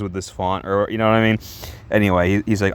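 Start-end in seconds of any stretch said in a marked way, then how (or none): none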